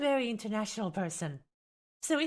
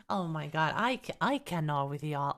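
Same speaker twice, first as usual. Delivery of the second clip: ordinary talk, nothing marked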